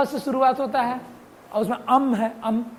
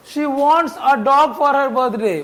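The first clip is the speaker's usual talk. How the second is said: slow dictation; much slower than usual. Shown as a demonstration. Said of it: "reads example slowly".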